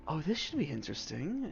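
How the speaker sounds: a soft, amused murmur to himself; a lightly voiced elf's soft aside; quiet aside. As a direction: mutter it under his breath, intrigued. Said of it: "he says".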